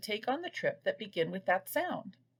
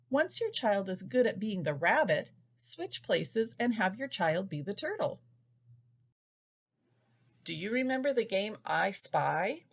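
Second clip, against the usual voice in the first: ordinary talk, nothing marked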